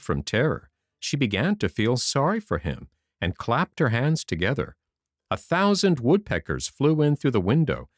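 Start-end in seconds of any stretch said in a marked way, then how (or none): none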